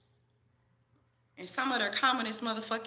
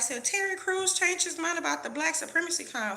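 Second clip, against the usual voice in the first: mocking happy fans; 7 semitones higher, 4 dB louder than usual. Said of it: "they were so happy clapping like".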